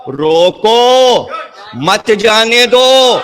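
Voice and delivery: long, high, called-out "روکو", pause, then "مت جانے دو"; pause after روکو. Shown as a demonstration. King ordering the army halted, drawn-out command split after روکو. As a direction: draw out "روکو" as its own command, break, then ring out "مت جانے دو". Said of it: "لہجے سے پتہ چلے گا".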